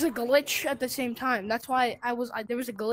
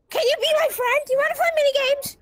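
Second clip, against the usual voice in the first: squeaky fake-sweet little kid; high, fast, sugary kid voice; 13 semitones higher, 4 dB louder, faster than usual. Mock friendly kid voice.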